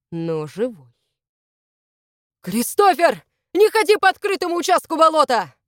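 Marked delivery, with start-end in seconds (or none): 2.4-5.5 high, loud call across a distance; shouted warning. A narrator's reading. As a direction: yell urgently to stop a friend. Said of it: "крикнул Доминик".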